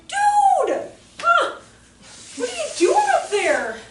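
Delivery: loud, very high disbelief; astonished call upward. Retold or imitated. Teacher spotting boy on ceiling, astonished high shout.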